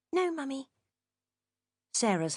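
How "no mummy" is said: put-on small meek girl, much higher; a little girl who's just been told off; chastised child. A narrator's reading. Jenny answers her mother meekly, high child's voice. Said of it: "looked suitably chastised".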